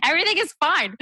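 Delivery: high, bright-faced laughing reassurance; mock-cheerful. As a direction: chirp it out, overly happy. Mock-cheerful exit interview quote.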